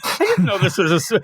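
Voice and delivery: high, flustered put-on voice; hammed-up dismay; upset Peacemaker. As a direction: panic a little, caught off guard. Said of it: "he's all upset".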